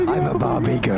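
monotone voice. Pretend. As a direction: drone it with no tune. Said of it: "just in a very monotone voice".

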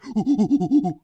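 loud, high run of hoots; energetic whooping. Shown as a demonstration. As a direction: whoop rapidly like an over-excited streamer. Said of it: "I'm not being".

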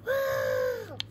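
high happy whoop; excited cheer. Real, not acted. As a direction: let out a big cheer. Cheering as they head over.

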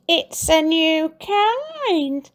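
sly Mrs Twit. A narrator's reading.

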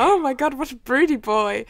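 high, loud swooning; fangirl gushing. Retold or imitated. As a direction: gush loudly over a fictional crush. Acts out gushing reader reaction.

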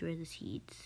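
breathy whisper to herself. Real, not acted. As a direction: say it under your breath. Repeats it whispered.

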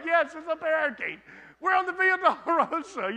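high, loud exasperated retort through a laugh; exasperated reply. Retold or imitated. Husband retorting to wife on the Via Dolorosa, exasperated. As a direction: answer her with comic exasperation. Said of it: "I'm like".

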